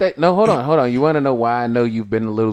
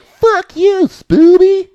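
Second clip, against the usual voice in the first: mock angry yell; 11 dB louder, much slower than usual. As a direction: yell it far up in pitch, stretching the insult. Redneck yelling from car window.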